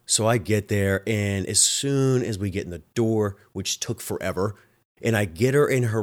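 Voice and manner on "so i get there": ordinary delivery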